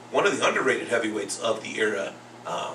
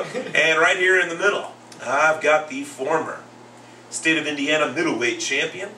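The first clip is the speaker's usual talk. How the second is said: show announcer; 4 semitones higher than usual. Acted. Host introduces fighting school teacher to listeners, announcer style. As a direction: announce the guest like a ring announcer.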